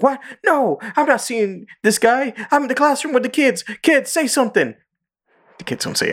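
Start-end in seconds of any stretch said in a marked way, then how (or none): 0.0-4.7 high mock panic on the phone; imitating Mister Garrison. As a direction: deny it nervously, pitched way up. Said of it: "he's like".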